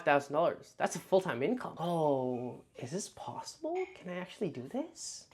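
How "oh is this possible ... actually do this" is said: quiet wonder. Retold or imitated. Wondering if possible.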